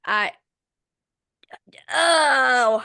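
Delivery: ordinary talk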